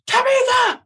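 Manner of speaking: high yell of the name, carrying across the gym; shouted name. Retold or imitated. Coach yelling the player's name across practice, a high shout. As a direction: call her out sharply from across the court.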